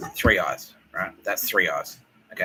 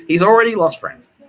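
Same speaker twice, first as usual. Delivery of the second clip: much higher, much louder outburst; sudden shout; 11 semitones higher, 12 dB louder than usual. Real, not acted. Yelling to listeners that Bill Burr lost friends. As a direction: blurt it out in a yell. Warning about Bill Burr's wrath.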